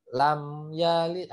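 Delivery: "lam" closed on the lips before "yalid"; stopped M. Shown as a demonstration. Teacher corrects the reading, M stops in "lam yalid". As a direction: hold the M of "lam" without bouncing. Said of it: "harus terhenti".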